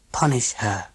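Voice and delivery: soft hoarse murmur. A narrator's reading. Beth urging the others quietly, hoarse and soft. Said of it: "said hoarsely and softly".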